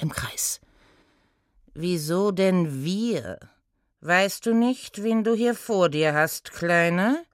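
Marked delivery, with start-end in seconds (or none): 1.7-7.2 lightly voiced condescending centaur; haughty centaur. Centaur to tiny apprentice, mildly condescending. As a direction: talk down to the little one. Centaur puts gnome in place.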